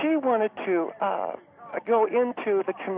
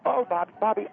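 ordinary talk

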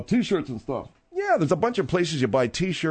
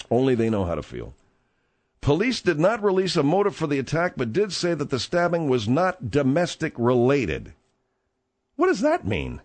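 ordinary talk

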